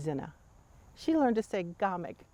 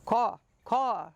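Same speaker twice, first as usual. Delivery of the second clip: 1 crow caw; 5 semitones higher, 4 dB louder than usual. Retold or imitated. Crow woman answers the girl.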